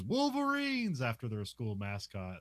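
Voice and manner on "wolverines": high rallying cry; mock war whoop, pitched high; battle yell. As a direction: shout the team name like a war cry. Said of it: "This is where Aardvark yells".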